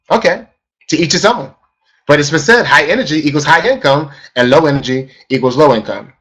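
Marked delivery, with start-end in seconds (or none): none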